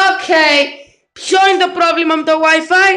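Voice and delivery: high-pitched exasperated question. Real, not acted. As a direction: demand to know what's wrong. Exasperated at failing Wi-Fi. (εκνευρισμένη, ψηλή φωνή)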